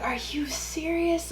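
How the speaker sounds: breathy whispered disbelief; stunned whisper. Retold or imitated. Overhearing boyfriend's argument, shocked whisper. Shocked aside while they argue.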